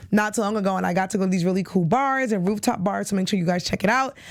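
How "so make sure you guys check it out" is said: normal talking voice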